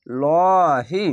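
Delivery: লহি held very long with a full heavy ল; heavy stretched lahi. Shown as a demonstration. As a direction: chant লহি slowly, thick and drawn out. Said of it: "আল্লাহ লেখাটি মোটা করা হবে". (মোটা করে টেনে পড়া)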